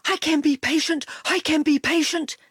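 fast eager dog pant. A narrator's reading. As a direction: pant it out while running. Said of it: "he panted".

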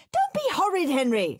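high, loud scolding scream. Acted. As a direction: mum losing her temper. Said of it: "screamed Mum".

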